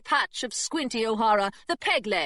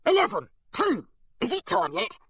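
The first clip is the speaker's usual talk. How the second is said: gargoyle voice; 7 semitones higher than usual. Acted. Gargoyle calling random numbers.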